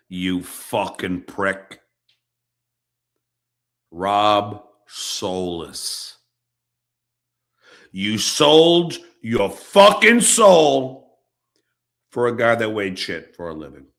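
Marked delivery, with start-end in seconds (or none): none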